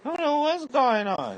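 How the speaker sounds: high, whiny falsetto of a bewildered neighbor; mock sick neighbor. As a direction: neighbor protests he was just fine, mock falsetto. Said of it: "the neighbor go home trying".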